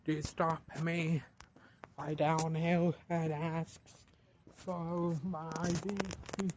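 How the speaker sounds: normal talking voice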